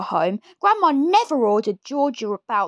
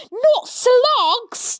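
much higher, loud horrified squeal; child's cry; 12 semitones higher, 3 dB louder than usual. Acted. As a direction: cry out in disgust like a small boy. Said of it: "George cried out".

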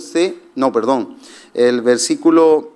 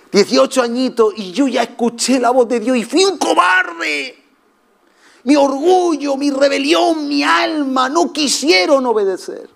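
impassioned cry; 10 semitones higher than usual. Real, not acted. Preacher crying out his past pride to the congregation. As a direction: cry out his youthful cowardice.

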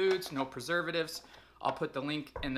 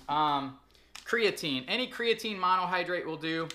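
normal talking voice

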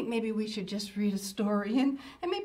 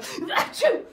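sneeze; 8 semitones higher, 8 dB louder than usual. Acted. Sneeze interrupts sentence.

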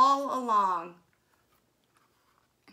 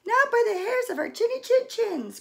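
much higher, louder frightened squeal; cried out; 13 semitones higher, 7 dB louder than usual. A narrator's reading. Two pigs crying out at the wolf, high. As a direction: squeak it out like terrified piglets. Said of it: "cried the pigs".

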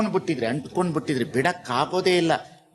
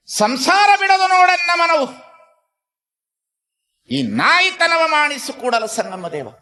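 high, loud, sustained chanting preaching; full-force verse declamation; 14 semitones higher, 7 dB louder than usual. Real, not acted. Preacher recites Basavanna's verse to the congregation, loud high declamation. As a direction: intone it loud and high, drawing out the line ends.

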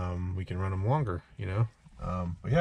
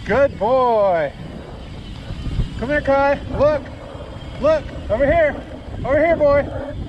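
calling from far away; 8 semitones higher, slower than usual. Real, not acted. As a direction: call out to the running dog. Calling dog on trail.

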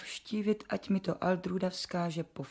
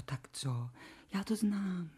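normal talking voice

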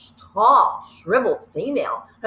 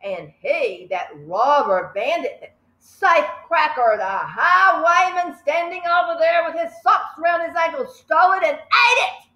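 higher, building headmistress tirade; Trunchbull tirade; 7 semitones higher than usual. Acted. Headmistress denouncing the boy to the school, higher and building. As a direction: work up to a shout at the culprit. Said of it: "reader voices the Trunchbull".

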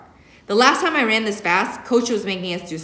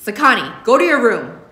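put-on sharp, louder command to a kid; stern order; 4 semitones higher, 7 dB louder than usual. A narrator's reading. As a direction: order him off to his room. Mother orders son away.

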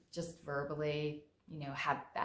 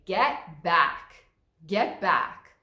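louder, firm warning to back off; healthy anger; 12 dB louder, much slower than usual. Shown as a demonstration. Someone in your face, telling them to get back firmly. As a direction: push them out of your space with your voice. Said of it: "that is a healthy anger".

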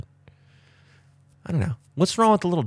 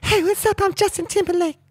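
much higher falsetto speaking voice; mock falsetto talk. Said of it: "You talk like that?".

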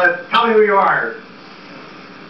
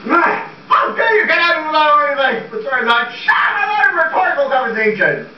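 furious rant; 8 semitones higher than usual. Acted. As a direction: blow up at him, higher and higher. Max rants at the accountant.

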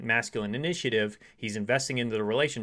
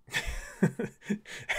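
breathy burst of laughter; amused laugh; 10 semitones higher, 4 dB quieter than usual. Real, not acted. Coach amused by her own sushi example, laughing.

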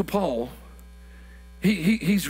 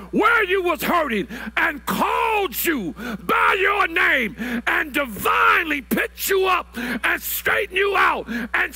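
preaching at full force; 7 semitones higher, slower than usual. Acted. Pastor hollering to the congregation about God's call. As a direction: lift the church with a hollering sermon climb.

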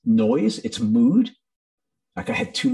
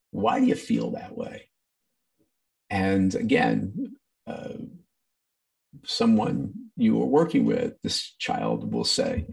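ordinary delivery

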